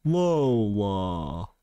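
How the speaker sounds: LOLA stretched out long and low; slow mimicry. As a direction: tease with a dragged, deep "Lola". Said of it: "But it came out as".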